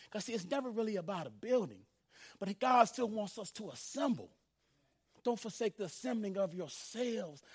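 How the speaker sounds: normal talking voice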